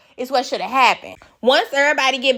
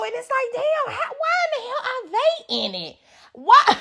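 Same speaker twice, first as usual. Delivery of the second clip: much higher, incredulous cry of why; exasperated disbelief; 13 semitones higher, 3 dB quieter than usual. Real, not acted. Host baffled that the crew is dragged in, incredulous. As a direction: throw up your hands at it.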